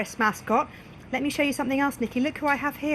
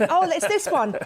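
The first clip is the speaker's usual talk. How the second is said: higher with a laugh on this; mock surprise; 7 semitones higher than usual. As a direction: tease the guest's choice. Mock surprise at guest's pick.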